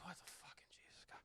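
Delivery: whispered, fast alarm; fast frightened whisper; startled whisper. Retold or imitated. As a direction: hiss it in shock in the dark. Eldest daughter woken at night.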